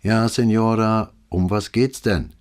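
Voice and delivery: lightly voiced indifferent officer; bored officer. A narrator's reading. Police operator taking a night call, lightly bored. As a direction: routine phone answer, a touch bored.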